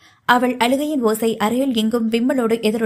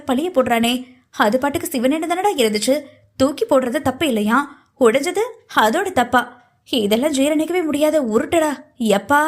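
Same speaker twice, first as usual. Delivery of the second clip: higher, lively mock-indignant heroine; exasperated rant; 3 semitones higher than usual. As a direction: grumble in disbelief, pitch swinging up. Heroine's exasperated inner rant at him.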